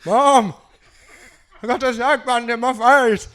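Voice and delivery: ordinary talk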